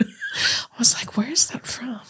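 whispering to herself, wondering. Retold or imitated. Recalling looking up the audio, whispering. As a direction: hush it like a private thought. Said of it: "whispered self-quote".

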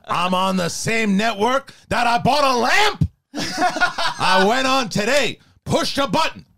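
Al Pacino. Acted. Actor grumbles about the network to listeners, yelling Pacino impression. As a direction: swing from disbelief up to a yell, then drop. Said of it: "Pacino just went from being like that really high voice".

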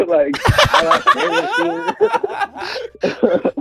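laughing through the words. Real, not acted. Laughing while telling friends he got scared. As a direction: giggles breaking up the admission, slowed down. Laughing at his own fear.

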